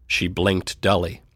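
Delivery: usual speaking voice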